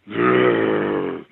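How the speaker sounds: deep, rasping asthmatic breath sound; imitating a heavy guttural wheeze; guttural breathing imitation. Imitating the creature's breathing to the listener, a deep asthmatic wheeze. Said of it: "it was a real, uh, guttural".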